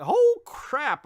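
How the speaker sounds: sudden high, loud "Oh, crap"; startled outburst. Pretend. Reviewer acting how a real first speedster would react, startled. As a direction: blurt it out in shock. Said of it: "I think it'd be more like".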